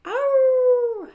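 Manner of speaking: long, high, loud howl; dog howl. A narrator's reading. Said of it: "howled Scooter".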